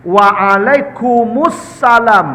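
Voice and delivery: Waalaikumsalam drawn out slowly, stressing the opening wa; careful slow model reply; slow demo. Shown as a demonstration. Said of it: "jawabannya wa, pakai wa".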